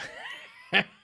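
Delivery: short breathy burst of laughter; real laugh. Real, not acted. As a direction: let a sudden laugh slip out. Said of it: "I shouldn't laugh".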